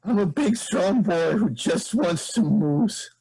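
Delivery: blubbering. Acted. Infected Johnson insists he's a big strong boy, blubbering loudly. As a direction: bawl it out through snot and tears. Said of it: "has also clearly been crying and snotting all over his face".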